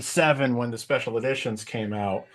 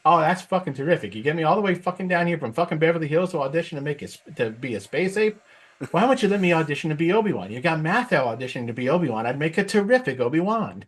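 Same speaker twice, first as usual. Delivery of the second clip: higher, fast, exasperated rant; indignant Jack Lemmon; 7 semitones higher than usual. Retold or imitated. Lemmon ranting at the director about playing a space ape. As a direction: sputter the complaint fast and offended. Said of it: "Jack Lemmon as Chewbacca".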